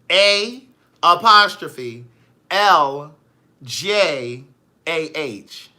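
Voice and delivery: loud, deliberate spelling, pause after each letter; spelled out slowly. Real, not acted. Spelling a viewer's unusual name, slowly and deliberately. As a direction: punch each letter separately, with gaps.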